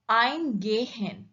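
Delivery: "eingehen" with EIN drawn out and punched; slow "eingehen", weight on its first syllable; stress on EIN. Shown as a demonstration. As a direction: lean slowly on the prefix "ein" in "eingehen". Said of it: "In ein".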